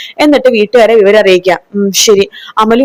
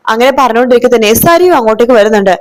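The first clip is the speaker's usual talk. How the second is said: ordinary delivery, nothing marked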